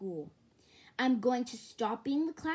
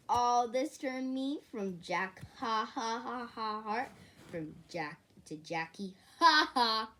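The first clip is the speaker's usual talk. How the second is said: ordinary talk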